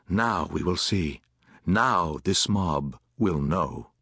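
low, slow inward thought; grim resolve. A narrator's reading. Soldier among grenadiers thinks to himself, grimly resolved. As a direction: weigh each word, pitched low.

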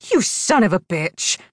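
furious captive cursing her captor; heated, punchy insult; angry cursing. A narrator's reading. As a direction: let loose the curse with anger. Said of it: "let loose a stream of curses".

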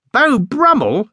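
very high, rising question on Beau Brummell; incredulous echo. A narrator's reading. Boy echoing the bear's claim, incredulous question. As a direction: echo it back in high-pitched disbelief. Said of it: "echoed Jonathan".

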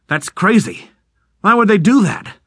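can't believe what he's hearing; shocked disbelief. Acted. Rickert stunned by betrayal news.

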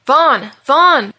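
high, loud cries of the name; anguished repeated shout; desperate yell. A narrator's reading. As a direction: call out for a lost friend. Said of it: "yelled Malachi as he fell to his knees".

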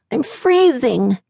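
put-on high, slow pleading little boy; sleepy child voice. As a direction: beg sleepily like a small child. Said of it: "Cooper's sleepy voice pleaded".

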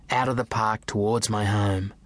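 ordinary delivery, nothing marked

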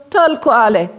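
high, insistent echo of sameness; emphatic confirmation. A narrator's reading. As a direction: answer emphatically, pitch well up. Emphatic answer about himself.

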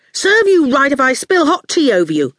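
angry. A narrator's reading. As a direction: say it crossly, starting high. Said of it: "Howard said angrily".

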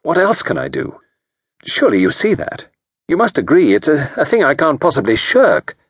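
insist, indignant that it's obvious; answered hotly. A narrator's reading. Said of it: "He had answered hotly".

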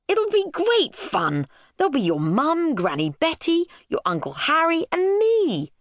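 high, over-keen dad; smiling enthusiasm. A narrator's reading. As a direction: sell the holiday with a big audible smile. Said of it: "smiling enthusiastically".

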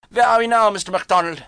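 high, calling German man; polite German asking; German accent. A narrator's reading. German voiced.

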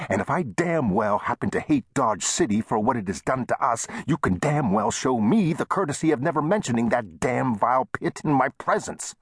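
indignant tirade climbing in pitch; heated. A narrator's reading. Shopkeeper ranting about the rival town, heated. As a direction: rant heatedly, pitch rising as it goes. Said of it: "Chester said heatedly".

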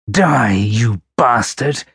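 slow, heavy, hateful threat; menacing snarl. A narrator's reading. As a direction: spit it slowly at the victim. Killer strangling his victim.

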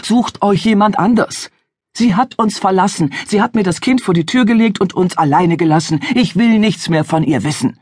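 father rejects the stranger, bitter and angry. Acted. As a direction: spit out the old hurt.

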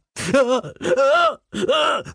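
high, falling whimper of pain. A narrator's reading. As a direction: let out a long pained whimper. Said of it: "wimmerte er".

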